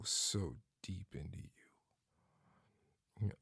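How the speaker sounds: slow unvoiced whisper. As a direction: breathe it out slowly. Intimate whisper mid-roleplay.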